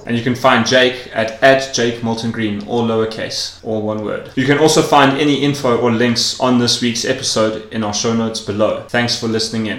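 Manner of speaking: loud, upbeat show plug; podcast outro read. Acted. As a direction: announce it like a radio host.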